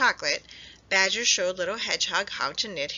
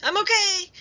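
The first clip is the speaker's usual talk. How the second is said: much higher, loud relieved shout; happy shout; 13 semitones higher, 7 dB louder than usual. A narrator's reading. Hedgehog caught by his hat, relieved shout. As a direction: shout up that you're safe. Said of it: "he shouted".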